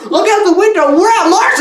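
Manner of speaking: high, eager announcement; mock excitement. Astronaut to crew, mock excited and high. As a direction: play the thrilled astronaut spotting Mars. Voicing excited movie characters.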